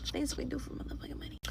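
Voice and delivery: fast breathy whisper of the same line; whispered repeat. Real, not acted. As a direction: echo it in a quick whisper.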